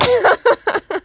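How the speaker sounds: high clicking dolphin squeal; dolphin impression. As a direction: chirp like a dolphin. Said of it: "that'd be a dolphin".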